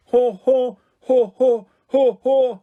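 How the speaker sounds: loud high haw-haw bray; two sporty parents bray a strange laugh; odd braying laugh. Acted. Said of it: "wasn't entirely sure was laughter".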